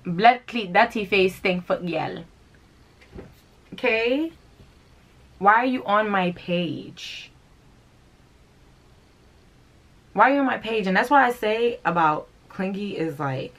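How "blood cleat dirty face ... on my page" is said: scolding mommy. Acted. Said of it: "let me talk to you like your mommy".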